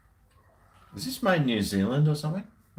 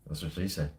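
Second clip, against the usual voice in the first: low, soft deadpan aside; muttered punchline; 7 semitones lower, 9 dB quieter than usual. Acted. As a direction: drop it under your breath, dry. Repeated deadpan joke.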